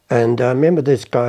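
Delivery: ordinary talk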